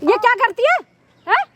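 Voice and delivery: outraged mother's tirade; scornful, indignant scolding; angry scolding. Acted. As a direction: berate him with indignant questions. Acted mother berates daughter.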